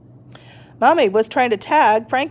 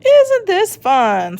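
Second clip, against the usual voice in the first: high, slow joyful cry; cried out; 10 semitones higher, 5 dB louder, much slower than usual. A narrator's reading. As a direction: cry out in delight. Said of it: "Skeleton cried out".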